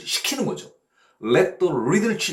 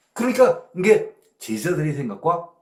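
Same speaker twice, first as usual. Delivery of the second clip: usual speaking voice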